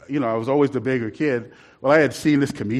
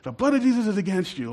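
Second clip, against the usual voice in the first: commanding rebuke; 6 semitones higher, 3 dB quieter than usual. Retold or imitated. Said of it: "like that".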